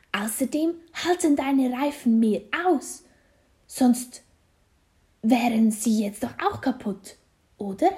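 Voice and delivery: high, loud lecturing, easing towards the end; mother's told-you-so scolding; fussy mother. A narrator's reading. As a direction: lecture the child emphatically. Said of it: "sagt Nicks Mama".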